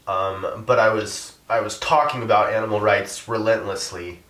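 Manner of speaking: normal talking voice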